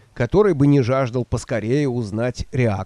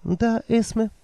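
put-on higher, timid old woman voice; meek old woman; 9 semitones higher, 4 dB quieter, slower than usual. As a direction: answer meekly, eager to please. Nanny voiced by narrator.

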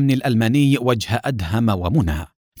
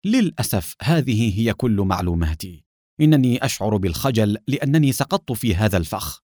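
usual speaking voice

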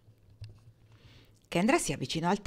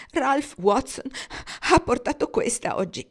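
higher, tearful, voice catching; voice broken from crying; 7 semitones higher, 6 dB louder than usual. A narrator's reading. Rosabel showing Kendra the letter, tearful broken voice. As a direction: speak through the last of her tears. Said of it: "la voce ancora un po' rotta dal pianto".

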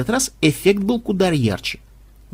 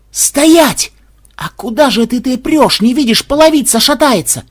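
high, loud alarmed yell; yelling warning; 8 semitones higher, 6 dB louder than usual. Acted. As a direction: shout to stop him now.

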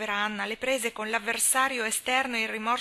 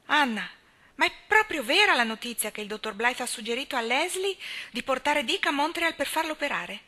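put-on louder, higher, alarmed old lady; indignant old spinster; 6 semitones higher, 7 dB louder than usual. A narrator's reading. Miss Cornelia confronting Anna about the operation, loud and alarmed. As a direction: burst in scandalised and loud.

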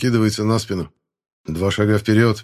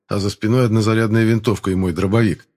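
ordinary delivery, nothing marked

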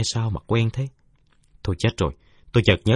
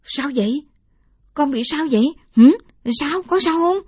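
much higher, worried grandma voice; fussing old woman; 6 dB louder than usual. Acted. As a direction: fret over the hurt boy like a grandmother. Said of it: "Bà Ái chạy lại xuýt xoa". (giọng bà cụ xuýt xoa lo lắng)

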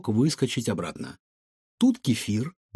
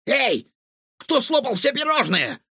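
high-pitched outraged demand; indignant; 11 semitones higher than usual. A narrator's reading. Cook finds the pastry tray empty, indignant. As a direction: call out the culprit in outrage. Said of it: "возмутился Чингисхан".

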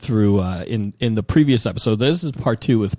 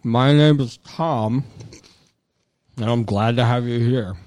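announcer intro; slower than usual. Acted. Podcast host intro.

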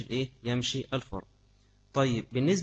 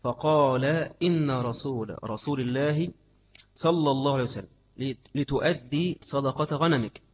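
hadith recitation; 3 dB louder, slower than usual. Acted. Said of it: "لقول سعر بن ديسم".

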